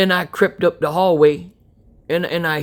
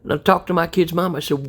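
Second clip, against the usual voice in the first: ordinary delivery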